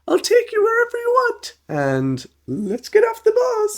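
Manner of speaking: very high falsetto, eager; squeaky overeager puppet voice; enthusiastic bus driver. Retold or imitated. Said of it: "so enthusiastic in helping the ladies'".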